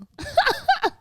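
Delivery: a short, high squealing laugh. Real, not acted. Embarrassed the ex might watch, squealing laugh.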